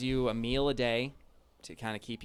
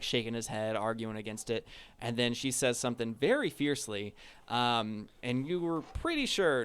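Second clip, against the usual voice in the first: ordinary talk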